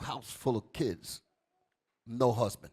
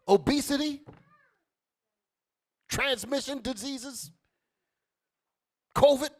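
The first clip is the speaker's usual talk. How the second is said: louder, higher list; fiery preaching; 9 semitones higher, 7 dB louder than usual. Acted. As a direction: build the list hard. Preaching list at full force.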